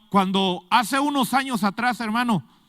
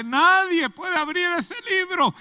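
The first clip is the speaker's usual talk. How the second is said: mock weeping lament; 12 semitones higher, 4 dB louder than usual. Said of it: "se pone a llorar y dice".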